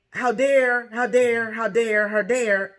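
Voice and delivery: loud, outraged stammering how dare; indignant mock outrage. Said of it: "had the gall and balls to say".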